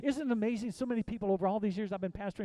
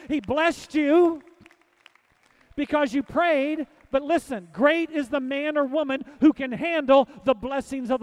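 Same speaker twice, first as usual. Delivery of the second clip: loud, high, slowed preaching cry; full-force preaching; 4 semitones higher, 8 dB louder than usual. Acted.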